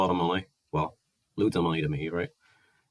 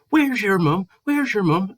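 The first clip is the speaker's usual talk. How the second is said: much higher and louder, childish mocking voice; mock high whine; 13 semitones higher, 4 dB louder, slower than usual. [name] mocks Gareth demanding his mum, high mocking whine. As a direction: parrot him like a whining kid. Imitating Gareth in retold row.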